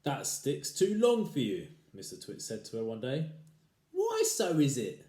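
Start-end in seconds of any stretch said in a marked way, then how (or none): 0.0-1.7 slow, louder mock concern; sly husband. Husband teasing his wife about her stick, slow and sly. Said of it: "beginning to enjoy himself". 3.9-5.0 surprised wife. Acted. Wife's voice in story.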